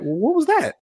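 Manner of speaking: high baffled question; confused. Retold or imitated. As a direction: ask it puzzled and high. Imagined confused viewer quote.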